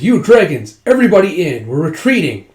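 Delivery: loud, high herding shout; retold battlefield command; acted urgent order. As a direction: round everyone up in a hurry. Ordering everyone to retreat.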